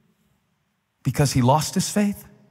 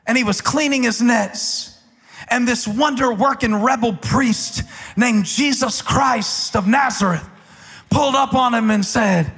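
full-force preaching; 9 semitones higher than usual. Acted. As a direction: preach it to the back row, rising and hammering.

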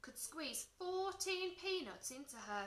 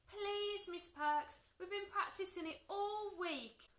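high, insistent begging; pleading; 10 semitones higher than usual. A narrator's reading. George begging teacher to see the trick, high-pitched pleading. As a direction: wheedle the teacher, pitch raised. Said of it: "George pleaded".

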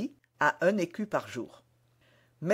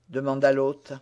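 usual speaking voice

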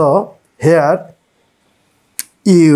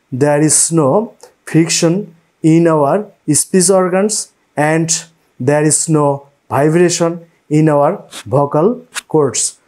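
ordinary talk

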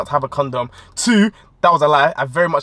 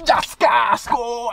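higher hammed-up yell fading; imitating her cries of go; mock shouted yes; 5 semitones higher, much slower than usual. Girlfriend urging him on, higher mock shout.

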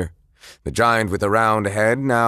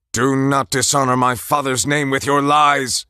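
raised, forceful warrior outburst; angry cry; 5 semitones higher, 4 dB louder than usual. Acted. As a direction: shout at the liar in defense. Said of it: "he cried".

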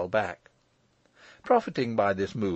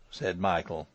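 ordinary delivery

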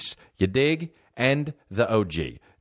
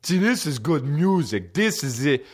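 higher, drawn-out goofy caricature; music buddy impression; 5 semitones higher than usual. Buddy praising the music to comic, mock gushing. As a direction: gush slowly like the imitated friend.

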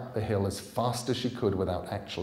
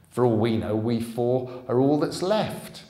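loud, higher panicked outburst; frightened henchman losing his nerve; rising alarm; 4 semitones higher, 10 dB louder than usual. Acted. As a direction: blurt it out in alarm.

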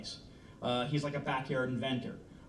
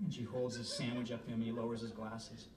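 ordinary delivery

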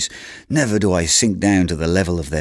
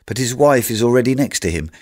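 ordinary delivery

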